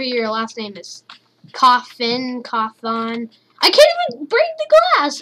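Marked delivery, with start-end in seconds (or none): 3.6-5.2 frustrated high outburst. Real, not acted. Frustrated at game.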